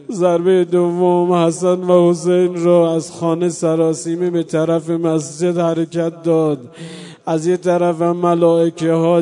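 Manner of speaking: loud mourning sermon at full force, half sung; impassioned preaching. Acted. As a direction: cry it out to the mourners in the dark.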